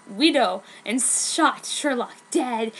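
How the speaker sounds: plain reading voice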